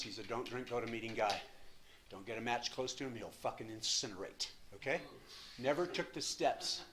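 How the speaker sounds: usual speaking voice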